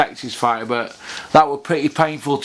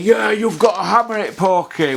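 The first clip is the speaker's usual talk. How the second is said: lightly voiced pushy fan, higher; mock fan; 5 semitones higher than usual. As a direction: nag him like an emailing fan. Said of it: "emails of people saying".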